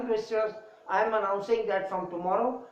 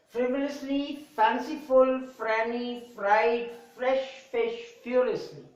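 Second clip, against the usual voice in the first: slow word list, each F word set apart; careful teacher drill of F words; 2 semitones higher than usual. Shown as a demonstration. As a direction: give each F word its own slow beat. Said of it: "in a slow rhythm".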